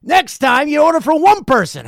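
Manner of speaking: high, loud stern lecture; scolding waiter. Retold or imitated. Waiter lecturing the diners on ordering, high loud scold. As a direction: lay down the rule loudly. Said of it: "waiter's last scolding".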